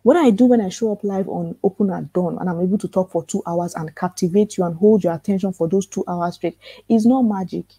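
ordinary talk, nothing marked

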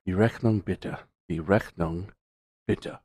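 soft, low practice run; rehearsing to himself. Retold or imitated. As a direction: imitate someone quietly rehearsing a foreign phrase. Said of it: "he's sitting there and he's like".

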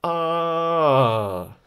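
long AH sliding down from very high; falling tone. Shown as a demonstration. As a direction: stretch AH through a gradual fall. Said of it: "Very high".

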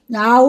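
loud, long "ow-ooo"; drawn-out wolf call; werewolf howl. A narrator's reading. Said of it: "the werewolf howled".

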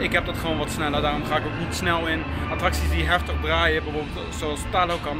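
ordinary delivery

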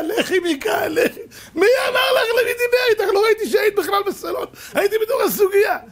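hammed-up exasperated quarrel; frantic protest. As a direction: cry out in desperate disbelief. Acted quarrel over the misunderstanding.

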